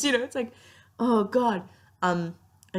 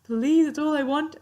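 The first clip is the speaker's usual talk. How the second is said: higher, pleading voice, slowing on the last plea; pleading Waverley; 9 semitones higher than usual. Retold or imitated. Fan imitates Waverley begging for a normal life, higher and pleading. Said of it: "Waverly's like".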